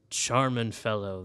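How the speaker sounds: normal talking voice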